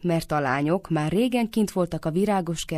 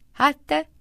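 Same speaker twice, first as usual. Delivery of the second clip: high, louder mock-surprised question; feigned surprise; 10 semitones higher, 5 dB louder than usual. A narrator's reading. Husband greets Kutila with pretended surprise. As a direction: act surprised to see them. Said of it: "színlelt meglepetéssel".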